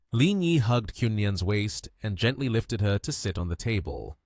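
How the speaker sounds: ordinary delivery, nothing marked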